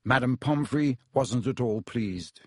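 ordinary delivery, nothing marked

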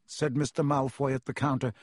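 usual speaking voice

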